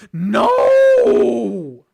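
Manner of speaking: high, loud scream of no; anguished scream. Real, not acted. Player seeing King take the beam, anguished high scream. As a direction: scream it as a friend falls. Pushed away as King steps in.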